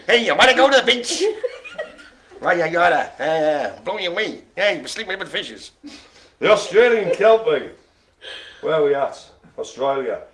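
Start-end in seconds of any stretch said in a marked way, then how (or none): 0.1-5.7 mock 1930s New Jersey mobster, quick and laughing; lightly hammed New Jersey wiseguy; New Jersey gangster accent. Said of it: "Blooming New Jersey".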